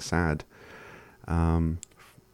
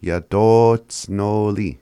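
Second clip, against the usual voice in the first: Yadotsnoli drawn out very slowly with a long O; slow pronunciation; 3 semitones higher, 6 dB louder, much slower than usual. Shown as a demonstration. Teacher repeating the word slowly for learners. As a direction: stretch Yadotsnoli out syllable by syllable. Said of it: "they become O".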